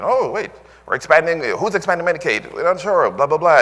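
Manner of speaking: high, flustered voice of confused people; mock confused excitement. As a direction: play the muddled crowd, all in a fluster. Mocking confused people on Medicaid.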